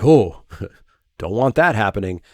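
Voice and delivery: mock opponent-deck shrug; dismissive quip. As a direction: toss it away with a laugh. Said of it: "be like".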